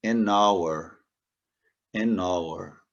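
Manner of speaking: "hour" with silent H, starting on the vowel; model pronunciation. Shown as a demonstration. Teacher models "an hour" for students, silent H. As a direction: say "an hour" with no H sound. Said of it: "aqui eu já tenho um N".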